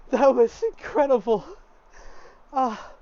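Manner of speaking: high, loud, awestruck exclamation; rapturous and loud; elated. Acted. Girl elated after the trance.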